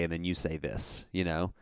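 ordinary delivery

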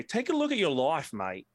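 broad Australian accent, pitched well up on the opening; Australian accent, drop the voice on mate. Shown as a demonstration. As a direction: slip into an Aussie voice to tune yourself in. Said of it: "you gotta say".

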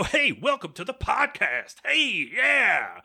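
silly high host voice. Shown as a demonstration. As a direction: greet listeners in a goofy high voice. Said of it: "We go".

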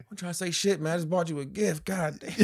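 high-pitched protest edging into laughter; mock exasperation. Man defending his gift to girlfriend, mock exasperation. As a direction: whine it as a hammed-up aggrieved boyfriend.

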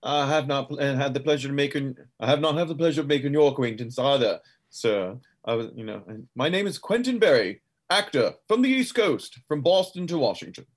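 theatrical East Coast actor, formal and self-announcing; highfalutin actor. Acted. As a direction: grandly introduce yourself, pitch climbing on name and title. Player voices Quentin introducing himself.

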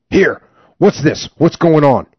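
captain yelling. Acted. Captain breaking up a prisoner beating, loud yell. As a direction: break up the fight with a shout. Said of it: "Captain Sells yelled".

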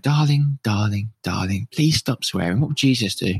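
put-on voice of a pleading mother; disapproving mum. Podcaster imagining mum hearing the swearing, imitated reproachful mum. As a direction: imitate Mum gently scolding you. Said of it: "I can hear my mum listening".

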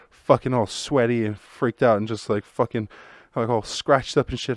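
ordinary talk, nothing marked

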